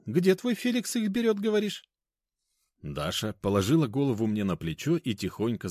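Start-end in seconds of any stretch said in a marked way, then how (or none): 0.1-1.8 put-on high, curious older lady; older woman voice. A narrator's reading. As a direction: ask it like a fussy aunt. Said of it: "заторопилась Дарья Ивановна".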